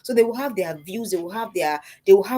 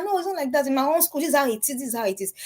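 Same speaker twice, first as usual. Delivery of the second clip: higher-pitched imitation of a child; opinionated child; 6 semitones higher than usual. Youngest child insisting on her own opinion, imitated child voice.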